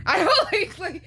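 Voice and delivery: high, loud burst through laughter; laughing. Real, not acted. Vlogger cracking up at own impression of being picky, laughing. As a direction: crack up while saying it.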